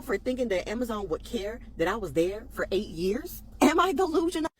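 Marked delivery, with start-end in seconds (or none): 3.6-4.5 higher, louder mock-disbelief; incredulous. Real, not acted. Fired worker asks her followers, high and incredulous. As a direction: toss the question out, incredulous. Rhetorical outraged question.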